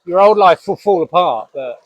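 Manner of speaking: usual speaking voice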